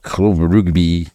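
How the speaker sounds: halting Welsh accent attempt. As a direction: slowly sound out in Welsh accent. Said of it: "Should I try it in a Welsh accent?".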